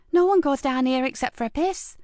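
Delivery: a cheeky lad asking keenly; young boy voice. A narrator's reading. Mickey asks Marcus eagerly, in a high young boy's voice.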